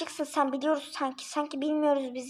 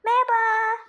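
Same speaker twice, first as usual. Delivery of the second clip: loud, high jump-out greeting; startling hello; 7 semitones higher, 5 dB louder than usual. Acted. Newcomer startling the kids with a loud high hello. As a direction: pop in and startle them.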